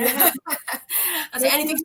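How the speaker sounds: giggling it out; amused laughing agreement. Real, not acted. Laughing agreement between sisters.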